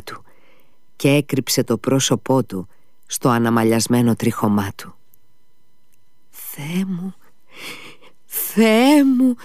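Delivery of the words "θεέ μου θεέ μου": high cry, first hushed, then swelling; exclaiming in amazement. A narrator's reading. Captain overwhelmed at seeing the dog, amazed cry. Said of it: "ξεφώνησε ο λοχαγός".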